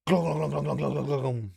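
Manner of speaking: a playful imitated glug sound; pouring glugs. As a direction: mimic liquor pouring freely.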